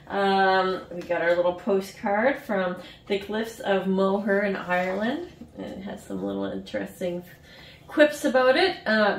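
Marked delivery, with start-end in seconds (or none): none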